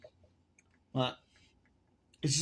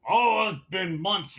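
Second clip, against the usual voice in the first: louder, higher, drawn-out imitation; mimicked concession; 5 semitones higher, 5 dB louder, much slower than usual. Bartender answering a customer's challenge, mimicked concession. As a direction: stretch out a mock admission.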